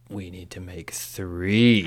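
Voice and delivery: slow, intense stage whisper; dramatic whisper. Retold or imitated. Podcaster acting an elf deciding on three rings, slow dramatic whisper. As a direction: whisper it slowly like a fateful decree. Acts Galadriel's dramatic pronouncement.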